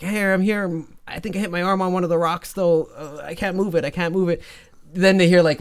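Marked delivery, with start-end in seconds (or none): 0.0-4.4 higher, lightly voiced kid in trouble; hurt little brother. Hurt little brother answering his brother from the water, lightly acted distress. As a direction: answer with a touch of helpless distress.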